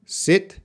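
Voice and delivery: short lax i in "Sit". Shown as a demonstration. Teacher contrasting "Sit" with "Seat". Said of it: "short I sound".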